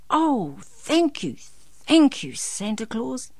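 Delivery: put-on high, delighted child voice; happy. A narrator's reading. Boy thanks Santa for the ride, happily. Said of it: "Darren said happily".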